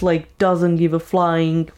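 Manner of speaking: ordinary talk, nothing marked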